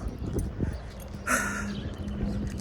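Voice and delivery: long breathy sigh of heat and fatigue; soft sigh, worn out by the heat; weary sigh. Real, not acted. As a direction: let out a tired, fed-up sigh. Hot, tired, fed up outside.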